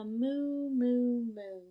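loud moo, then fading moo; playful cow sounds. Retold or imitated. Imitating cow in picture book.

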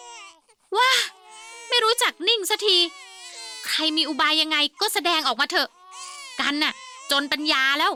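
exasperated despair. Acted. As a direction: give up on the wailing babies. Despairing over crying babies.

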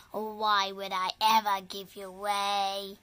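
ordinary delivery

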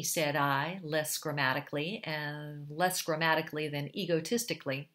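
ordinary delivery, nothing marked

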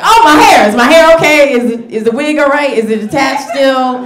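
loud, high mock panic over the wig; panicked about wig. Comic reliving the crash, loud mock panic over her wig. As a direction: fret loudly about the wig after the crash. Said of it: "the first thing I was like".

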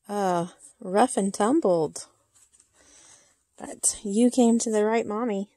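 normal talking voice

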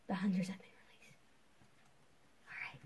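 hushed whisper. Real, not acted. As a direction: whisper so the hunters can't hear. Whispering while hiding in game.

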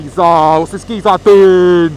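loud, high shout; shouting at driver. Real, not acted. Rider on the road yells at a driver, loud and high. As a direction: bellow it at the careless driver.

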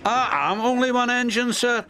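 high, keen protest; eager plea. A narrator's reading. Donald to the Fat Controller, eager plea. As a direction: put yourself forward, pitched high.

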